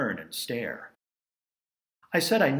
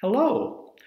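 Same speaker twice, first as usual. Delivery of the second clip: tour guide greeting; 12 semitones higher, 6 dB louder than usual. Retold or imitated. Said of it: "what I've said thousands of times".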